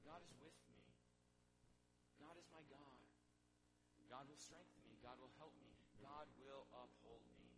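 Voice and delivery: a calm, trusting affirmation said low; quiet confident declaration. Shown as a demonstration. Pastor modelling a prayer of trust for the congregation, softly. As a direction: softly declare each promise. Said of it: "say it like this".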